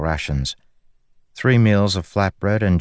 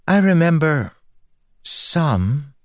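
put-on high, halting girlish spren voice; light, high voice, searching for a memory; Syl the spren; 11 semitones higher, slower than usual. A narrator's reading. Spren recalling the realms for the stranded group, high and halting.

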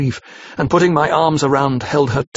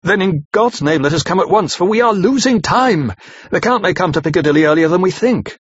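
raised, higher urgent call to go now; crying out; 6 semitones higher than usual. A narrator's reading. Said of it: "crying out".

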